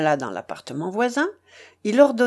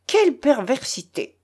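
higher, scandalised bishop; shocked exclamation; 6 semitones higher, 3 dB louder than usual. A narrator's reading. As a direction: exclaim in pious horror. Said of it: "s'écria l'évêque". (exclamation horrifiée)

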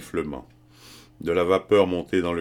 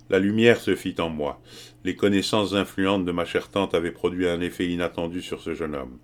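plain reading voice

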